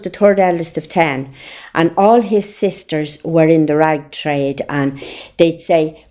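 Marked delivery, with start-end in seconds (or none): none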